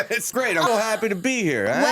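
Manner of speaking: high, lively put-on New York accent; New York accent impression. Comedian greeting host as guest, put-on New York accent. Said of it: "give such a good New York, uh, impression".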